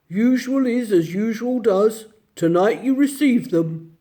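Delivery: a lightly voiced gruff bear gatekeeper. Bear at the gate welcoming the children, lightly gruff. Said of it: "the gruff voice said".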